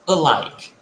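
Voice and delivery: "alike" as a-LIKE, falling at the end; light first syllable. Shown as a demonstration. Teacher repeating the pronunciation for students, light first syllable. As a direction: model "alike" with the stress on LIKE. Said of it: "Essa pronúncia".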